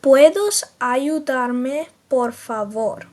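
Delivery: very slow, careful Spanish; teacher modelling Spanish slowly; slow Spanish demo. Shown as a demonstration. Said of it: "you would say".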